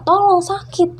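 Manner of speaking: angry ghost. Retold or imitated. Ghost girl losing patience with the speaker, loud and angry. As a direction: snap it out in sudden fury. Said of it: "sampai akhirnya dia marah".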